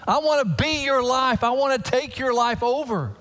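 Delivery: lifted, earnest voice of God; voicing God. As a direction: speak as God inviting in. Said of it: "God says".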